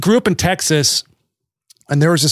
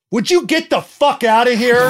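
mock angry yell; 9 semitones higher than usual. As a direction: snap at the intruder in a shrill mock rage. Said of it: "then he'd be like".

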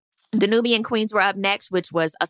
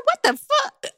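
much higher, stunned outburst; shocked; slower than usual. Retold or imitated. Hearing the candle announcement, stunned. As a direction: react in disbelief. Retelling her reaction.

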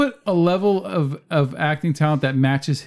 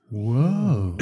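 low, drawn-out surfer whoa; Keanu whoa; 4 semitones lower than usual. Retold or imitated. Imitating Keanu's catchphrase in a comedy sketch. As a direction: stretch out a slack-jawed whoa. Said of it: "repeat after me".

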